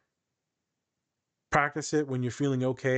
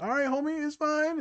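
mock reassurance. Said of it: "And I'm like".

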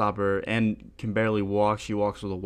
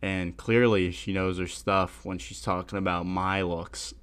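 usual speaking voice

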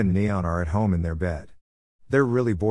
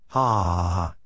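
villain laugh; 3 semitones lower than usual. A narrator's reading. Dan gloats over Kim's loss, mock villain laugh.

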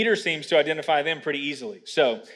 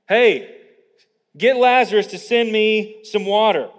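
loud, higher mock summons; entitled request; 6 semitones higher, 8 dB louder than usual. Preacher mocks rich man wanting Lazarus to serve, entitled request. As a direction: order it like summoning a servant. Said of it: "He still just wants to save his butt".

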